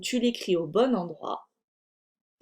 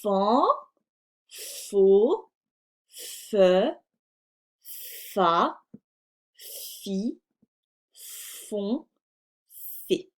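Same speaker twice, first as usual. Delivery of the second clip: fan faux fe fa fi fon fé said one by one with pauses; syllable reading; much slower than usual. Shown as a demonstration. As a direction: read each syllable slowly and separately. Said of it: "Alors, on y va". (lecture syllabe par syllabe)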